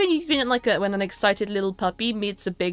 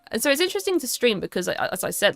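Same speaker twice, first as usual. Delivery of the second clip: normal talking voice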